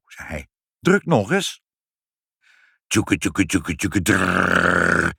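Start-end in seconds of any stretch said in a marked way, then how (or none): 0.8-1.6 higher, mildly impatient order. A narrator's reading. Father tells child to press the button, impatient. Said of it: "Toen werd vader ongeduldig". 2.9-5.1 chugging machine ending in a rolled rattle; machine noise. A narrator's reading. The potato machine starts up, imitated chugging. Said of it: "zei het apparaat".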